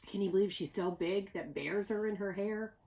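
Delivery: ordinary delivery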